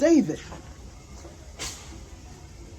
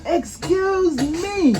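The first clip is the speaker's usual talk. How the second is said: high, drawn-out mock yell; mild acted yell; 6 semitones higher, much slower than usual. David being rude at the table, mock yelling. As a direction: stretch it out like a rude child calling out. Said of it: "oh, he's yelling".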